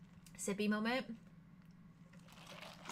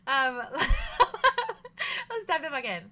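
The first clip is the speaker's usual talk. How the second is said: laughing; 3 semitones higher, 8 dB louder than usual. Real, not acted. Laughs at herself.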